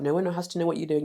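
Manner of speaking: usual speaking voice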